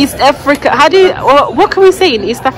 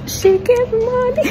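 quoted catchphrase; 13 semitones higher, slower than usual. Retold or imitated. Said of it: "my friend said to say".

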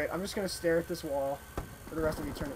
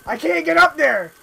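loud, high frustrated shout; frustrated yell; 8 semitones higher, 11 dB louder than usual. Real, not acted. Frustrated gamer yelling at game.

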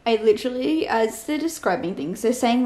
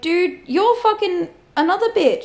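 exasperated rant; 7 semitones higher, 4 dB louder than usual. Real, not acted. Ranting at a book character.

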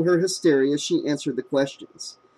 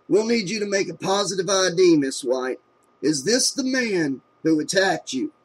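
routine officer; 4 semitones higher than usual. A narrator's reading. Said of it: "the officer said routinely".

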